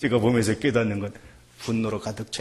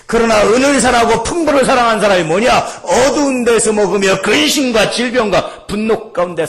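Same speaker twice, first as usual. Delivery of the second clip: loud, high, emphatic warning; full-force preaching; 9 semitones higher, 10 dB louder than usual. Real, not acted. Preacher warning of a life in darkness, forceful. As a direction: hammer out the rhetorical question and answer.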